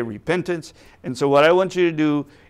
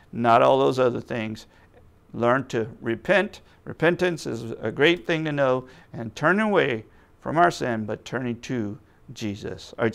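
usual speaking voice

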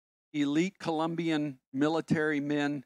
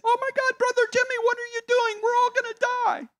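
much higher squeaky panicked voice; Muppet-like panic; 4 dB louder, faster than usual. Retold or imitated. Colombians in the car panicking, in high Muppet voices. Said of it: "voices were raised up to the level of Muppets".

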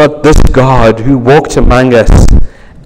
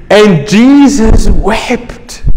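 and Jesus wept, drawn out and slow; solemn slow statement; slow reverent; 5 semitones higher, much slower than usual. Acted. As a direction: let the words hang, slowly. Repeated climax line.

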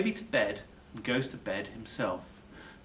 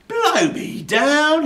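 slow, much higher, louder exclamation; astonished burglar; 11 dB louder, much slower than usual. A narrator's reading. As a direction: draw it out in pure amazement. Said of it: "he says".

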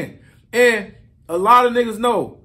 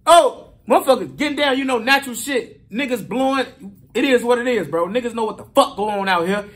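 normal talking voice

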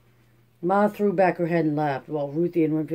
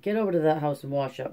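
ordinary delivery, nothing marked

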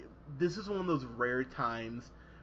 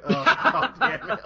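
laughing, higher and louder exasperation; laughing groan; 9 semitones higher, 9 dB louder than usual. Real, not acted. Reviewer mocking the Vampa pun, laughing groan. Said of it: "it's like".

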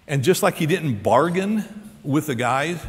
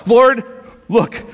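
louder, higher, eager promise; eager pledge; 7 semitones higher, 5 dB louder than usual. Acted. Zacchaeus answering the Lord, loud and eager. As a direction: blurt it out like a man set free.

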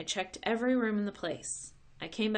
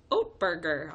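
cheery; 7 dB louder than usual. A narrator's reading. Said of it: "all cheery".

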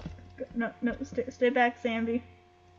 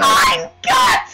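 terrified scream; 17 dB louder than usual. Real, not acted. Sudden scare in the game.

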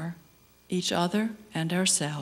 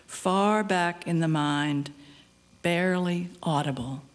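normal talking voice

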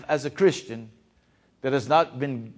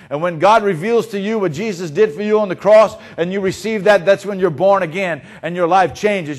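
loud, driving sermon delivery; full-force preaching; 5 dB louder than usual. Acted. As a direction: preach it hard to the congregation.

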